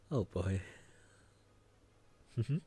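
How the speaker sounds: ordinary talk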